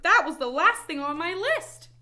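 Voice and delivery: high, loud, excited Toad; big storybook shout. A narrator's reading. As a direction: yell it in triumphant realization. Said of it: "shouted Toad".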